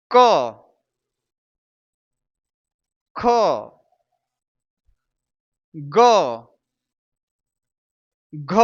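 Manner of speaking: क, ख, ग, घ as isolated "kuh, khuh, guh, ghuh"; short syllables क, ख, ग, घ, long gaps between; letter sound model. Shown as a demonstration. Teacher showing how letters are pronounced, spaced-out syllables. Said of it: "इसका उच्चारण".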